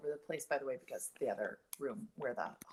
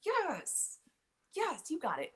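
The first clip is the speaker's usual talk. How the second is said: mock cheering; 12 semitones higher, 4 dB louder, much slower than usual. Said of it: "I'm like".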